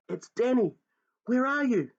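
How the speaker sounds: high loud calls; shouting. Acted. Boy shouting for his father in the wood, loud and high. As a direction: shout desperately into the night. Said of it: "I shouted".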